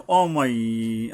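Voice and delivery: "অমাই" with a long nasal hold on the final glide; one-alif ghunnah. Shown as a demonstration. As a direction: slowly draw out the end of "অমাই" through the nose. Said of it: "এক আলিফ পরিমাণে গুণনা করা". ("অমাই"-র শেষে গুন্নাহ করে টেনে)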